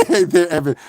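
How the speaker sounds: normal talking voice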